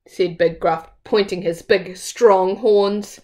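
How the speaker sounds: plain reading voice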